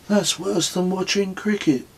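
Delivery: deadpan joke in Kez's voice; Kez's quip. A narrator's reading. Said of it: "said Kes".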